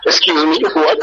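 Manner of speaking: high-pitched puzzled "what?"; baffled listener. Acted. Puzzled local who cannot follow the foreigner's accent, baffled. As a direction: ask as if you understood nothing. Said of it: "They look at you like".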